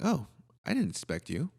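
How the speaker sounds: normal talking voice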